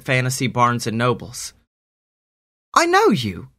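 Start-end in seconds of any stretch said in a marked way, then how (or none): 2.7-3.5 put-on high girl voice. Harmony recognizes Sammy.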